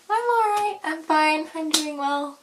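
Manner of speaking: high, sing-song polite answers; fake cheerful. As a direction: put on a chipper front. Routine fake answers, pitched way up.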